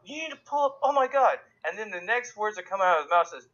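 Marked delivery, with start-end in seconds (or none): 0.0-1.4 mock freak-out. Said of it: "the girl freaks out".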